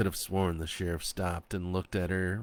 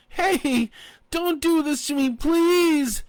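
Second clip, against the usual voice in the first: high, loud begging; pleading shout; 10 dB louder, slower than usual. A narrator's reading. Woman shouting at the men leaving her. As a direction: shout and plead not to be left. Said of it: "she shouted".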